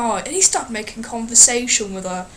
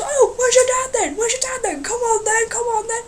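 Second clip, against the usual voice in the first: mock taunting guy; 10 semitones higher than usual. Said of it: "the guy turns around and goes".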